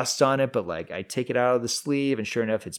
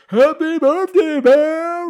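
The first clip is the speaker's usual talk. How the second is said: very high, slow, full-out yell; booming mock birthday shout; 10 dB louder, much slower than usual. Dad flinging the door open on his son, shouting. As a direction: burst in hollering, stretched out.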